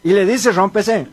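normal talking voice

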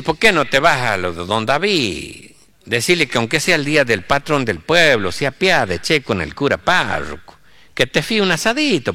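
pleading rural priest with a rising lilt; wheedling village priest; poor country priest. Acted. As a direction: coax the sacristan in a sing-song country voice. Hungry priest instructs his sacristan.

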